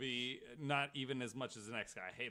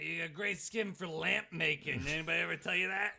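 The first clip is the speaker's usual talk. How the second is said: a hammed-up creepy compliment, fast and louder; playful creepy serial killer voice, a bit higher; mock creepy killer; 5 semitones higher, 7 dB louder than usual. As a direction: leer it out like a creepy old man. Mock Gein creepy pickup line.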